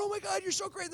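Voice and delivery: high-pitched excited fawning; gushing praise. Retold or imitated. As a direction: rave like a starstruck fan. Costar gushing after premiere.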